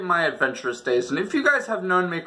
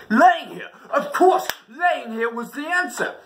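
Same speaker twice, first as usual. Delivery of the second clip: eureka excitement; 10 semitones higher than usual. Acted. Sudden idea.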